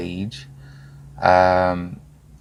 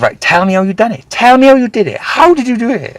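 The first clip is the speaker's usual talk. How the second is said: much higher, louder, pressing for the secret; eager hounding managers; 9 dB louder than usual. Retold or imitated. Managers hounding the record-breaking salesman, high and pushy. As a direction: pester him like a starstruck manager. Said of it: "I was getting hounded".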